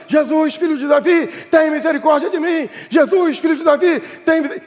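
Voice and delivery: high, insistent cry for mercy; shouted plea. Acted. Blind Bartimeu crying to Jesus over the crowd, shouted plea. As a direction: call out over a crowd trying to silence you. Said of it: "mais ele grita".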